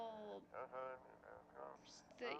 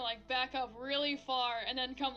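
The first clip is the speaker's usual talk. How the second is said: normal talking voice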